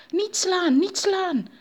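high, loud panicked plea; alarmed pleading; frightened cry. A narrator's reading. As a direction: beg not to be hit, pitch shooting up. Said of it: "riep Anansi geschrokken uit".

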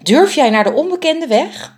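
high, loud alarmed question; startled. A narrator's reading. The biggest bird, startled, asks the smallest one if she dares fly. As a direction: blurt it out in disbelief.

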